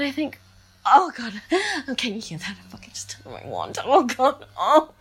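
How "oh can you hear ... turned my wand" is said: low, quick, hushed whisper. Real, not acted. Telling listeners about the toy, hushed and quick. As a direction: let it slip out as a fast secret.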